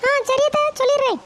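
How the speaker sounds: daughter-in-law voice. Acted. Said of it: "மருமகளே, போய் coffee கொண்டு வாமா".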